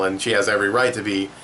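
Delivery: ordinary talk, nothing marked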